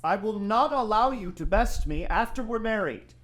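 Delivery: called after his bride-to-be as she races ahead; calling out. Acted. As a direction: shout it after her as she gallops off. Theo calling after her while riding.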